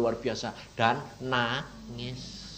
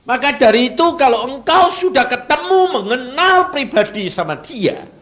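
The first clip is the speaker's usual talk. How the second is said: louder, higher, driving preacher's call; fiery preaching; 13 semitones higher, 15 dB louder than usual. Acted. Preacher urging the congregation to know God. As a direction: preach it out to the whole room.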